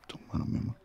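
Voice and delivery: tender whisper. Acted. Asking his girlfriend for her hand, soft whisper. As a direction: breathe it close to her ear.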